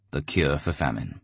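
ordinary delivery

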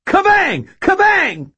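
very high, loud spell scream. A narrator's reading. Young wizard casts spell at bottles, screaming. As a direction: hurl the spell word as a scream. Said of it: "and screamed".

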